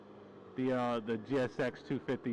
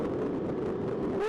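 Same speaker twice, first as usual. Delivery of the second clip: excited whoop. Real, not acted. Cheering on the bike.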